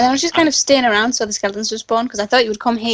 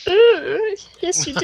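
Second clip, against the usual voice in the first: imitating drunk voice; 7 semitones higher, slower than usual. Shown as a demonstration. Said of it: "That one's worse".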